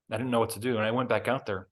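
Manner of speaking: usual speaking voice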